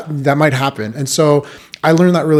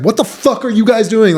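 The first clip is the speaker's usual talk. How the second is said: higher, sharp angry demand; angry sergeant; 8 semitones higher than usual. Retold or imitated. Sergeant scolding lazy privates, angry higher snap. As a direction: bark it at the slacking privates. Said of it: "he was so angry at us".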